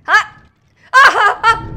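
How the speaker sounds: loud, high yelps in a burst; startled yelps. Real, not acted. Player startled mid-game, loud startled yelps. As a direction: cry out in short, high bursts.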